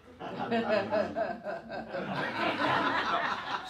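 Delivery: loud, high burst of laughter; hearty laugh. Real, not acted. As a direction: laugh out loud at his own joke. Audience laughs at the joke.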